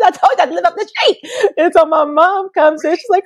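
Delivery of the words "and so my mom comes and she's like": ordinary delivery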